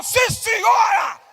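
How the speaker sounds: high, loud, slow obedient yell; shouted reply. Retold or imitated. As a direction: bellow the answer back like a drilled kid. Boy shouting back to mother.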